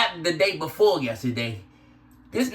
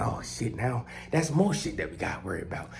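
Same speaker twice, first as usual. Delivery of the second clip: hushed worry; 8 semitones lower, 8 dB quieter than usual. Retold or imitated. Hushed worry over the dog.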